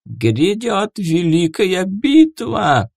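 high, slow shrieking oracle; shrieking prophetess. Acted. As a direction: shriek it like a possessed seer. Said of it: "взвизгнула Пифия".

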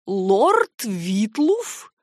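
very slow, stretched, rising question; surprised question. A narrator's reading. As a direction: draw out the name in astonishment. Aunt startled by unexpected guest. (протяжный удивлённый вопрос)

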